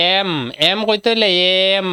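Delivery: "এম" stretched to expose its opening "এ" sound; pronunciation demo. Shown as a demonstration. Teacher asking students how it is pronounced, stretching "এম" from its vowel. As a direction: draw out "এম" so the leading vowel is heard. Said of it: "এটার উচ্চারণ কিরকম হইছে?".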